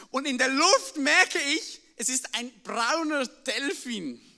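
ordinary delivery

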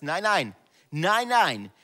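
loud, rising rejection of the enemy; defiant no. Shown as a demonstration. Preacher shouting down the enemy's lies, firm loud refusal. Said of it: "dass du sagst".

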